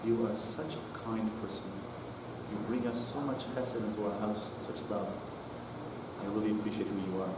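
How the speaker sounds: soft, hushed praise right in her ear; whisper. Retold or imitated. Father whispering praise to his daughter after the blessing, softly. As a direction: murmur it close and low so only she hears. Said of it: "I gave her a whisper".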